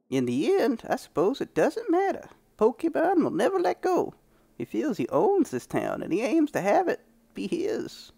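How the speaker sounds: put-on high, grizzled old prospector; old man prospector voice. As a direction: play a crusty old prospector. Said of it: "doing a freaking old man prospector voice".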